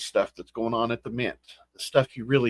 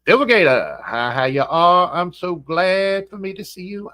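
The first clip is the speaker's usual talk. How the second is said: ordinary talk, nothing marked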